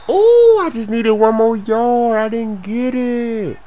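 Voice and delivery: high, stretched-out lament; drawn-out wail. Real, not acted. Gamer one yard short, drawn-out wail. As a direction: howl the near miss slowly. Wailing at a near miss.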